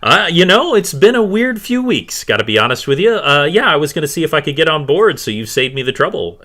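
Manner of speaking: high, loud, hurried chatter; animated captain character voice; easygoing ship captain. Acted. Game master plays Captain Jackson.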